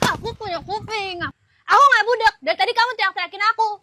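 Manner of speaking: loud, quick retort; angry protest. Acted. First take, she argues.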